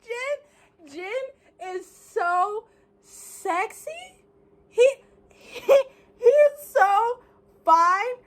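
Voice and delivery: high, choked-up fan gushing; half crying with delight; teary swooning. Real, not acted. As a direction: swoon until the voice cracks. Fan swooning over the photo.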